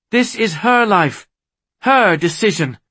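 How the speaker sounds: high, loud, emphatic protest; defiant insistence. A narrator's reading. Argues with sword drawn.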